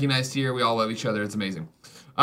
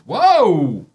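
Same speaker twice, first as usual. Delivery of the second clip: loud, drawn-out whoa; startled exclamation; 3 semitones higher, 7 dB louder than usual. Real, not acted. Host interrupted while reading a best friend nomination, loud surprise. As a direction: cut in with a loud burst of surprise.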